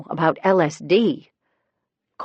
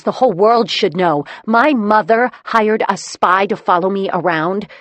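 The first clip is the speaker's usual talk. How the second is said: angry; 5 semitones higher, 5 dB louder than usual. A narrator's reading. Daughter angry at mother.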